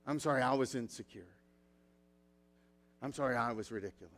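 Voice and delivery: soft, quiet, sincere confession; gentle apology to a spouse; humble apology. Retold or imitated. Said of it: "the humility sometimes just says".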